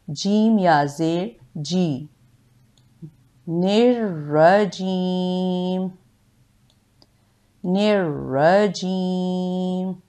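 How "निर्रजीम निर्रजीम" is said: निर्रजीम slowly, the rr held and doubled. Shown as a demonstration. Teacher modelling निर्रजीम for learners. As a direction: hold the rr in निर्रजीम. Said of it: "तशदीद है".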